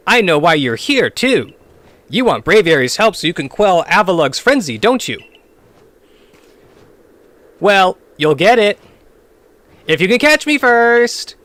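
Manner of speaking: character voice. Acted. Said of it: "how you do the voice act".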